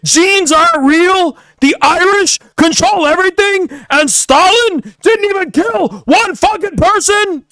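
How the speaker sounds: high, loud mock rant that rises to a shout; Lucas Gage raging. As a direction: ham up a furious rant, voice climbing high. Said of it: "He's like".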